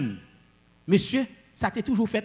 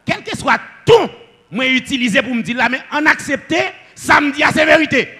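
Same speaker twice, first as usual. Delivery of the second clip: high, loud insistence; emphatic preaching; 8 semitones higher, 7 dB louder than usual. Real, not acted. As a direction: drive the point home at a raised pitch. Preacher insists any tone states truth. (predikasyon ak gwo vwa)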